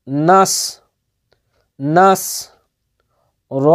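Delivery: ordinary talk, nothing marked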